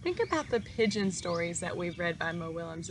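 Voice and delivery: usual speaking voice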